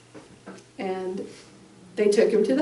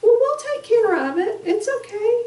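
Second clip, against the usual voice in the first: high, airy dismissive reassurance; high mock-calm paramedic voice; 12 semitones higher than usual. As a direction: brush off the worried mom.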